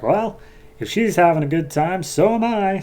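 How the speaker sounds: a lightly voiced mischievous goddess; mock Strife. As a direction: toss it off playfully, pitch up. Strife teasing, retold.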